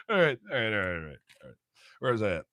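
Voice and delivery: usual speaking voice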